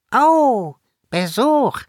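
high, loud delighted fairy greeting; gleeful welcome to unexpected guests; joyful. A narrator's reading. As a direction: chirp with happy surprise. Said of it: "sagte sie freudig".

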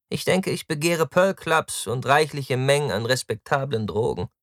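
high, loud opening, then easing; flippant, high-pitched loafer; carefree Taktus, sarcastic. Acted. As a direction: answer the scolding with flippant airs. Narrator voices Taktus retorting.

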